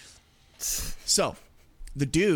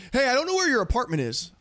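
higher-pitched mock irritation; snippy delivery driver; 7 semitones higher than usual. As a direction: imitate a testy driver on the phone. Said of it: "so he's snippy".

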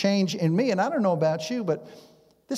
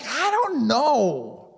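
higher, louder mock-angry retort; acted anger; 4 semitones higher, 5 dB louder than usual. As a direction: act out being angry for no reason. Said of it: "find myself getting angry".

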